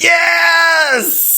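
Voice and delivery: big excited yell; loud shout. Real, not acted. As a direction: burst in with a shout. Co-host's loud entrance.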